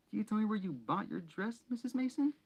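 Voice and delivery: high, fussy put-on voice; Aunt Petunia voice. A narrator's reading. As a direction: gush like a proper hostess. Petunia voiced in read story.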